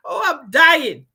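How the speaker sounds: hammed-up high, loud wail; mock dying. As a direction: fake being at death's door, drawn out. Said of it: "Yes, he's dying".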